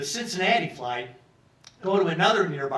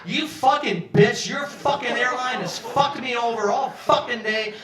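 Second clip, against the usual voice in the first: retold angry screaming; 7 semitones higher, 5 dB louder than usual. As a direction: hurl the curses like a fed-up passenger. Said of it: "I started screaming at her".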